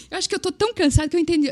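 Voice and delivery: high, very fast, flustered quote; acted disbelief. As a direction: rattle it out in mock confusion. Retells exhausted disbelief at offer.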